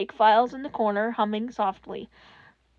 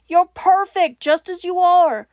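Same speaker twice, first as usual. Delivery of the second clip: fervent; 5 semitones higher, 6 dB louder than usual. A narrator's reading. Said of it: "what he wanted to say".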